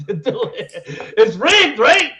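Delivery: high, loud, laughing challenge; laughing taunt. Acted. Mocking people who say the Cup is rigged, laughing taunt. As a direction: laugh as you throw it back at them.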